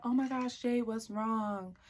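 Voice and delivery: exaggerated sympathy, stretched at the end; imitating concerned friend. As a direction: play the oblivious friend fussing. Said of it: "And so she's like".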